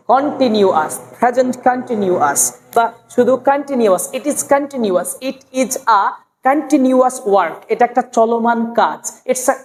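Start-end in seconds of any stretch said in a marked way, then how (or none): none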